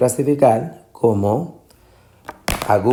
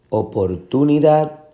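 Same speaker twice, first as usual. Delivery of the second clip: oportunidad with TU raised high; misplaced middle stress; 5 semitones higher than usual. Shown as a demonstration. Teacher asking which is right, opor-TU-nidad. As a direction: jump up on TU in oportunidad. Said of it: "la fuerza de la pronunciación".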